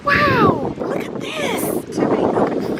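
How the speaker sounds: high, drawn-out wow of amazement; awestruck. Real, not acted. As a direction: marvel at the view, high and slow. Awestruck at the countryside view.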